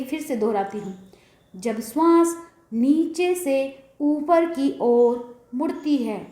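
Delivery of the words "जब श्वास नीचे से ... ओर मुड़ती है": drawn-out, phrase-by-phrase sutra reading; very slow recitation. Real, not acted. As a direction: repeat it very slowly, stretching each phrase. Sutra repeated slowly for the listener.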